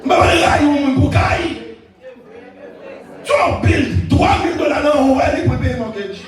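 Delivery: fervent preacher rousing the congregation; preaching at full force. Acted. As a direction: shout the message to the church.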